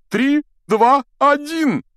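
high, loud starting count; shouted countdown. Acted. Teacher starting the boys' duel, shouted countdown. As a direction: call out the count, high and ringing.

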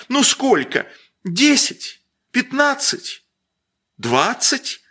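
всё выше с каждой ценой; набавляй цену, нетерпеливо; торг нарастает. A narrator's reading. (барыня нетерпеливо набавляет цену)

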